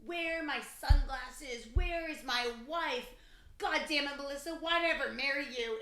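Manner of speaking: loud mock-angry husband; imitated drunken rant. Drunk newlywed berating his wife, imitated rant.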